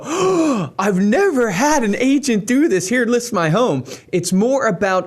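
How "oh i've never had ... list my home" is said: gushing seller. Pretend. Mocked homeowner dazzled by a magic pitch, hammed-up awe. Imagined seller wowed by agent.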